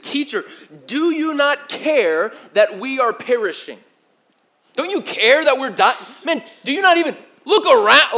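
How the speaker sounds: high, loud, pleading yell; upset rebuke. Retold or imitated. Disciples yelling at Jesus in the storm, upset. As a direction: shout it like a frightened accusation. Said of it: "and they said".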